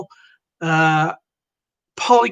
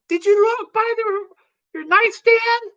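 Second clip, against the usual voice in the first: wife's voice; 13 semitones higher than usual. Acted. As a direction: ask it in a much higher wifely voice. Wife voiced in skit.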